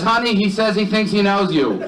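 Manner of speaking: high-pitched call to his wife; exaggerated husband calling back; husband relaying to wife.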